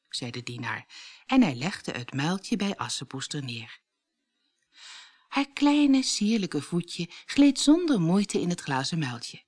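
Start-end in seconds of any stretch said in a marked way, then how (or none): none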